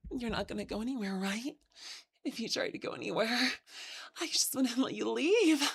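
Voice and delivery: soft, eerie possessive murmur; yandere. Pretend. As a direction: whisper sweetly but threatening. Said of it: "we're gonna do some Yon Dandy".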